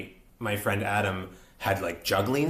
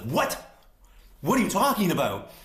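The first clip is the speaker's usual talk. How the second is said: high-pitched incredulous questioning; retold disbelief; 8 semitones higher than usual. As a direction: act out stunned disbelief, voice jumping up. Retold disbelief at getting role.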